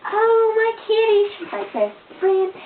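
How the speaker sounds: very high, slow, loud cooing at the cat. Real, not acted. Talking to her kitty in high baby-talk cooing.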